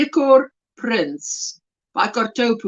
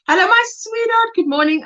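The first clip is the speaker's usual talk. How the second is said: higher-pitched affectionate greeting; voiced chat greeting; 8 semitones higher than usual. Reading Jen's morning hello to the chat, higher voiced greeting. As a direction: read out a friend's sweet hello in a higher voice. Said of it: "she says".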